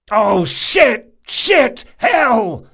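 loud, high-pitched cursing outburst; panicked shout. A narrator's reading. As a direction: shout each curse in helpless alarm. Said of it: "Locke shouted".

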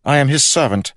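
high, loud royal proclamation; king's recitation. A narrator's reading. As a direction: declaim it like a rehearsed royal creed. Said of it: "It sounded like a recitation".

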